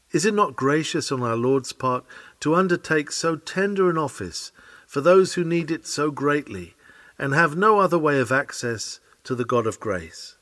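ordinary talk, nothing marked

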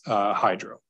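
usual speaking voice

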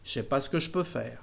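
quick and relaxed, the ne dropped, "Je sais" run together as "chais"; fluent casual French. Shown as a demonstration. Teacher showing students casual spoken French, fast and relaxed. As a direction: rattle it off like a native, fast and loose. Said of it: "When you speak fluently, you can say".

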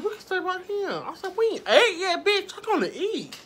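high, bright fake-casual voice with a laugh; fake cheerful. Stalling her date with high fake cheer. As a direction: sell the lie sweetly, way up high. Faking cheer to stay at table.

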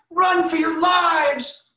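very high, slow, loud cry; panicked chicken shriek. Acted. Reading aloud, the chickens panic and run. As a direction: scream for everyone to flee.

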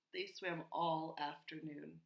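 plain reading voice

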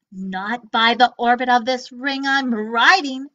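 loud defiant refusal; stout defiance. Acted. Gork defying the robot, loud and stout. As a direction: stand your ground boldly. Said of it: "cried Gork stoutly".

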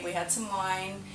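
usual speaking voice

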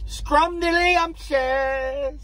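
very slow, high, savored word; drawn out. Real, not acted. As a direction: stretch every syllable out. Savoring word about her.

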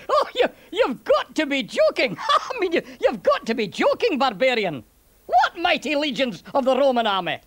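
chuckling Scots soldier, pitch leaping about; incredulous, laughing Roman with a Scots burr; scoffing Scottish centurion, amused. Acted.

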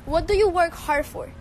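ordinary delivery

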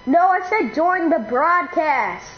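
slow, patient repeat; slow insistent. Real, not acted. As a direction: spell it out slowly. Repeating slowly.

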